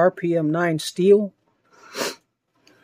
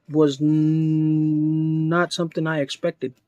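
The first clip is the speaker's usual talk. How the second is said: normal talking voice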